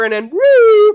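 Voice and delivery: a high, loud imitated siren whoop. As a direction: sing out the police siren in one swoop. Police car appears behind.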